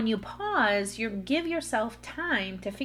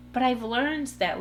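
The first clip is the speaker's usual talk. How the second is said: ordinary talk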